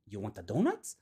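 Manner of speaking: low, rising bait question; teasing offer. Retold or imitated. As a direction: dangle the offer in a low voice, lifting at the end. Teller baits JD with donut again.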